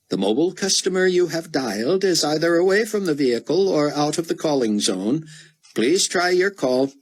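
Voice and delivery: high, even automated phone message; recorded announcement. Acted. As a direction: read it like a recorded operator.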